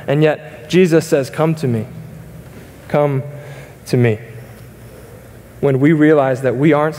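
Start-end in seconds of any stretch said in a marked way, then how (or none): none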